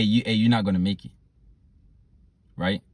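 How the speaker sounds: ordinary talk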